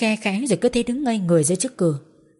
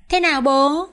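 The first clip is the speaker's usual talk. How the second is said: put-on high, raised childish question; eager little girl; 6 semitones higher, 3 dB louder than usual. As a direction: press your dad like an impatient child. Child character asks.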